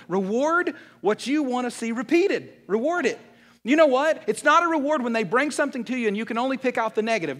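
loud, high, hammering preacher cadence; full-force preaching. Acted. Pastor telling parents to reward kids, loud preaching. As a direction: drive the point home to the crowd.

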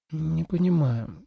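soft, slow puzzled mumble; mumbling. A narrator's reading. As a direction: mutter it under your breath. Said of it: "пробормотала Су Илань".

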